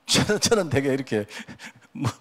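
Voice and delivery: laughing. Real, not acted.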